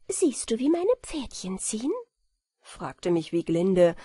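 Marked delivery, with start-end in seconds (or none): 0.1-1.9 put-on girlish fairy queen. A narrator's reading. Wieglinde speaks in story.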